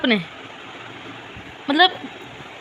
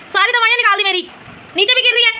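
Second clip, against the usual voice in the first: sharp, much higher exasperated scold; angry scolding; 8 semitones higher than usual. Real, not acted. Mother scolding daughter for emptying her medicines, high sharp scold. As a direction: tell her off, shocked and cross.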